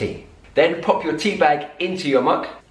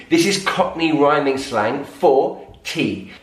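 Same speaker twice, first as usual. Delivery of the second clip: ordinary delivery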